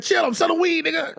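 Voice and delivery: put-on very high, squeaky baby voice; high-pitched mock toddler; baby selling weed. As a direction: imitate a baby talking like a street dealer. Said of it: "he saw a baby on the corner. He said".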